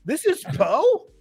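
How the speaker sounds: high, incredulous exclamation; retold disbelief. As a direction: act out stunned disbelief, voice shooting up. Retold disbelief at British actor.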